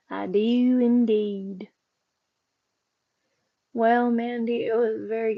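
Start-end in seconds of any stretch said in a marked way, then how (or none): 0.1-1.7 slow, drawn-out Southern drawl on indeed; playful Southern accent, stretching each word; hamming up a Southern twang. Pretend. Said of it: "I often flow into a kind of a southern accent".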